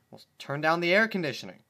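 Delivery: loud, fast, high blurt; flustered. Acted. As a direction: rush it out flustered.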